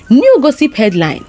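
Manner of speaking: plain reading voice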